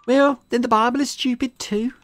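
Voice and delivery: put-on high, sassy kid retort; defiant girl voice. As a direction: toss it out defiantly. Yan's voice.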